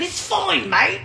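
high, loud, drawn-out caricature rant; mock scolding musician. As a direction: berate the fan in a put-on voice. Acts musician's loud reply.

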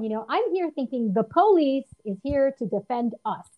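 ordinary talk

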